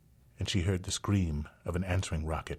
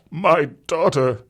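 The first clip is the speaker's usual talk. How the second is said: high, loud, slow sobbing cry; 11 semitones higher, 10 dB louder, slower than usual. Acted. Father finds his daughter alive, high sobbing cry. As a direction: cry it out in relief. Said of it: "he sobbed".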